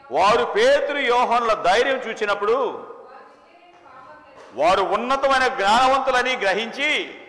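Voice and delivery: loud, high scripture reading with a planted mistake; preacher tests the congregation with a wrong reading; deliberate misreading. Shown as a demonstration. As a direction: read it out boldly, wrong on purpose. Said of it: "నేను అది తప్పు చెప్పినప్పుడు".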